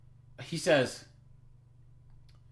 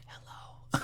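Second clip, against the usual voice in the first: soft, voiceless imitation; creepy whisper; 14 dB quieter than usual. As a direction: whisper a furtive greeting. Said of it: "he's probably, like, in his own house by himself, like, whispering".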